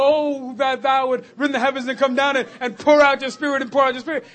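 loud, pleading cry to heaven; loud pleading prayer. Retold or imitated. Congregation praying for revival, loud pleading cry. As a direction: call out the prayer like the church begging for revival. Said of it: "So we say".

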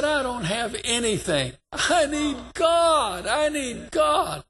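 high, slow, loud pleading cry. Retold or imitated. Preacher retelling the drunk man crying out loud. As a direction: wail it out for God. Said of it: "screaming, crying out loud".

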